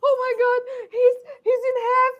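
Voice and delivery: high, loud panicked wail; horrified. Acted. Violet sees the separate bodies, horrified high wail. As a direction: wail it high, thinking the worst. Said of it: "she thinks about the worst".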